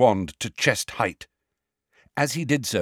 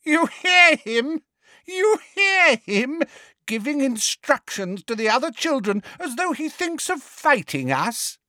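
mad mocking Bellatrix. A narrator's reading. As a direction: taunt like a mad witch. Bellatrix cackling mockery.

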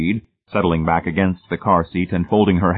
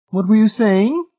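high, louder prodding question; urging; 6 dB louder than usual. A narrator's reading. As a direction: press for an answer in a high lady's voice. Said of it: "Missus Pritchett urged".

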